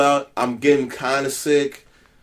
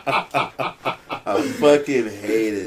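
laughing; 5 semitones higher, much slower than usual. Real, not acted. Laughing about turning thirty-one.

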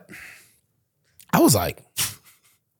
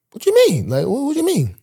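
mock confusion; 10 semitones higher than usual. Confused after teammate's news.

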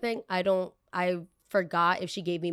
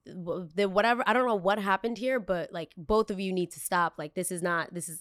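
ordinary delivery

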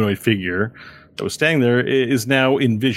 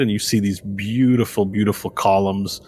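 normal talking voice

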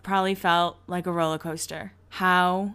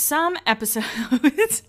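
slow, higher, drawn-out "some episodes" with a rising end; 6 semitones higher, much slower than usual. Shown as a demonstration. Vlogger fumbling the word, drawn-out slow take. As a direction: stretch "some episodes" out slowly and lift the end. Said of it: "how many times can I say episodes in a row".

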